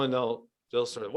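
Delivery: ordinary delivery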